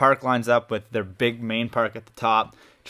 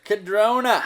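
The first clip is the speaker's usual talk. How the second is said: excited; 10 semitones higher, slower than usual. Real, not acted. Eager to answer, blurts favourite.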